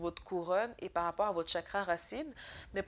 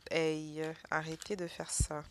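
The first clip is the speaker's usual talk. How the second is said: scolding the cards; 3 semitones lower than usual. Real, not acted. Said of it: "je parle à mes cartes comme si je parlais à un".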